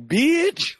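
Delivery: high, loud, outraged "Bitch"; sharp exclamation. Retold or imitated. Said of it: "and I'm like".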